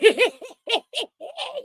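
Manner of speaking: high-pitched cackle; gleeful laughter. Real, not acted. Host laughing at the busted couple, gleeful cackle.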